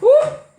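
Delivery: shrill high squeal of alarm; high whoop. Real, not acted. Squeal at blender.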